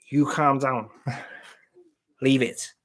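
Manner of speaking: mock Scouse, calm down calm down; hammed-up Liverpool scally; Scouse accent. Shown as a demonstration. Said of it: "That's the best I can do".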